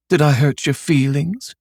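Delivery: mock-concerned sarcastic question. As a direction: sneer it sweetly. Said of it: "she asked sarcastically".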